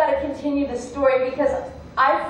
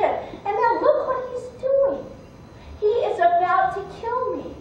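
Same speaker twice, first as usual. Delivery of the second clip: high, urgent plea for help; acted distress, appealing to a witness; alarmed appeal; 7 semitones higher than usual. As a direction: point out the danger, voice raised in pitch. Man pleads his case to dog.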